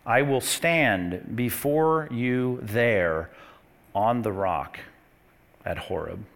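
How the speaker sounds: each phrase drawn out slowly with pauses; slow careful reading. Shown as a demonstration. Pastor slowly reading scripture aloud to his congregation. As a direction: read it slowly so they catch every word. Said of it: "Note it carefully".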